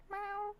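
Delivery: short, high imitated meow; cat meow. Shown as a demonstration. Streamer showing how they meow back at the cat, high meow. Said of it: "I'd meow at it".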